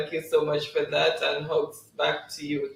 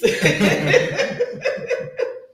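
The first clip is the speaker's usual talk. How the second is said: long rising laugh, higher and louder; 6 semitones higher, 4 dB louder than usual. Real, not acted. Host reacting to viewer's joke, laughing. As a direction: let the laugh roll up.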